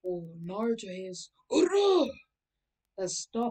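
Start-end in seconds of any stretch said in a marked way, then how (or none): 1.5-2.1 huge high shouted battle cry; stand battle cry. Acted. Naruto's stand yells as it punches, huge battle cry. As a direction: bellow it like a fighting spirit striking. Star Platinum punches the bully.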